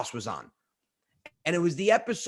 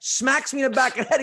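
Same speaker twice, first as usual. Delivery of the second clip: ordinary talk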